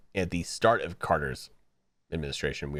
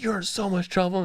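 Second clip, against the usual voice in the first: mother scolding the teacher; 9 semitones higher than usual. Retold or imitated. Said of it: "she's like".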